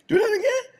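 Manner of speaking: squeaky high, laughing "Do that again?"; a delighted, incredulous laugh-squeal; amazed question. Retold or imitated. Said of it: "I was like".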